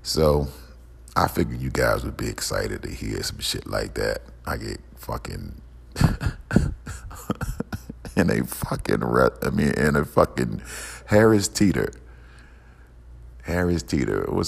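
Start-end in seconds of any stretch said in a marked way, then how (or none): 5.9-8.1 long unvoiced laugh; real laugh. Real, not acted. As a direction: break off mid-sentence into wheezy laughter. Laughs while recounting the incident.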